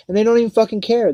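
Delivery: sudden loud, fast burst of anger; angry outburst. Real, not acted. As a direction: spit it out fast and furious. Rant about police not caring.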